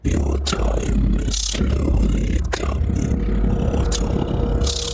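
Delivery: slow, eerie whispered menace; ominous whisper. Acted. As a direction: breathe it slowly like a haunting voice. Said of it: "Sounds like a nerd".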